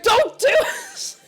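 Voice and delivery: high, drawn-out laughing shout; mock warning yell. Dutch people warning off a learner, imitated high yell. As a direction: cry it out in mock horror.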